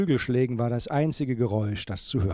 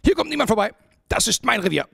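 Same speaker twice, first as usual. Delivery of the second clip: high, loud territorial outburst; angry porcupine; 10 semitones higher, 5 dB louder, faster than usual. A narrator's reading. Porcupine bars the way to intruders, angry. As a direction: shout them off your turf, pitched way up.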